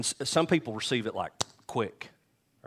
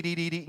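put-on higher, sing-song baby syllables; mock baby babble; 8 semitones higher, faster than usual. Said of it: "I feel like dee".

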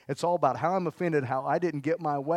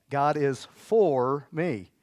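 stretched slowly, a little louder; very slow declaration; 4 dB louder, much slower than usual. Shown as a demonstration. Pastor repeating the confession, very slow. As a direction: lay each word down slowly.

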